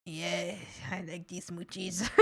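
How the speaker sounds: very soft, low drowsy voice; sleepy dog voice. Pretend. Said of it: "and he was like".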